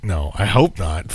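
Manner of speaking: ordinary talk, nothing marked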